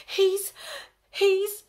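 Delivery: high, loud stammering gasps; teacher too horrified to finish; breathless shock. A narrator's reading. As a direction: choke on the words. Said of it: "panting heavily".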